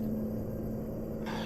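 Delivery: soft, fed-up exhale; exasperated sigh. Retold or imitated. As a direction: sigh at the queue ahead. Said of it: "so I'm like".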